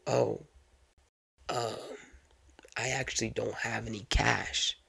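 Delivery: soft, halting mock passenger; hesitate, then admit it quietly; sheepish admission. Passenger tells cab driver he has no cash, sheepish.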